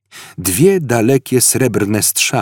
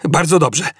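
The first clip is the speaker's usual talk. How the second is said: raised-pitch, decisive exclamation; called out; 7 semitones higher than usual. A narrator's reading. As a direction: call it out to the crew, no real shout. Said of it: "zawołał".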